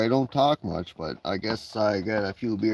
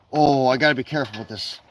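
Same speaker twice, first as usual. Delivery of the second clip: usual speaking voice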